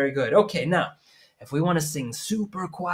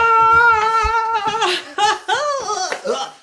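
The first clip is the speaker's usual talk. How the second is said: big laugh; 7 dB louder than usual. Real, not acted. Laughing after gum mishap.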